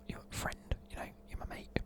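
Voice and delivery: ordinary delivery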